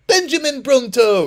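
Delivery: loud, high, crisply articulated presenter name; Benjamin Bronto. Retold or imitated. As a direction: announce it like an over-clear French host. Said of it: "speaking so clearly".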